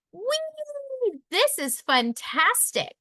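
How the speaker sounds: high gleeful squeal on the waves; a child thrilled to be riding a wave; delighted whee. Acted. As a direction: squeal with joy while surfing. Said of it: "I said".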